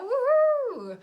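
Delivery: very high, loud woohoo; celebratory whoop. Real, not acted. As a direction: let out a long, high whoop. Celebrating mother and daughter joining.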